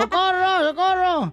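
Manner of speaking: high cries for help; comic yelling homeowner; acted shouting. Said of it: "el dueño de la casa que estaba gritando".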